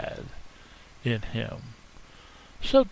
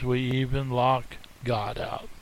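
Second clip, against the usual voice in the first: usual speaking voice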